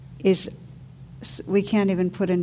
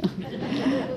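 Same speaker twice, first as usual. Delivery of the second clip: soft laugh; 8 dB quieter than usual. Real, not acted. Amused reaction to story.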